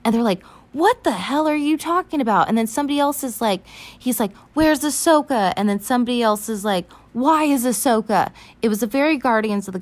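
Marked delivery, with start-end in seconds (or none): 0.7-2.5 high, loud mock disbelief; raised-voice comic outrage; indignant retort. Acted indignant reply to Anakin. 4.5-5.5 loud, high mock outburst; urgent, raised-voice demand; fiery demand. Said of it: "just so fiery". 7.1-8.3 loud, high comic retort; raised-voice parody of a demand; mock shouted question. Acted parody of shouted exchange.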